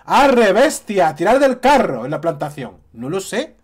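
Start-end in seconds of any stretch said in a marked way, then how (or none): 0.1-2.1 mock shout. Vlogger imagining a real abuser yelling at a victim, mock shout. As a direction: bellow it like driving a cart animal. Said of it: "al grito de".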